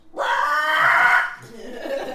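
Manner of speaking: high, loud imitated dragon screech; long shouted beast cry; dragon roar. A narrator's reading. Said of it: "and responds".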